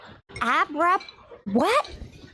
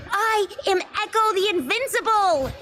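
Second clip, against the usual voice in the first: a higher, grand announcement of her hero name; hero transformation call; 7 semitones higher than usual. Acted. Girl shows how a hero would announce the name, proudly. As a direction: proclaim it like a hero's battle cry. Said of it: "transformation call".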